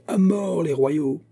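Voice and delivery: high, drawn-out rallying cry; cri exalté. A narrator's reading. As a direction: stretch it like a crowd's war cry. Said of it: "crièrent les plus exaltés". (cri exalté, aigu et traînant)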